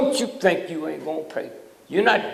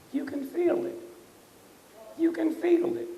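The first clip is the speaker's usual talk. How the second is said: pitched way up, pressing the point; high pitched; 13 semitones higher, 5 dB quieter than usual. Real, not acted. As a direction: up high, almost pleading. Repeated to a crowd.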